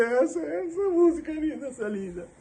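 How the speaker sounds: high, wavering cry of recognition; a teary high rush of joy and grief; tearful cry. Retold or imitated. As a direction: say it as if breaking into tears over the song. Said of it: "Eu comecei a chorar".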